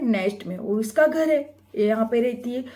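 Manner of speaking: ordinary talk, nothing marked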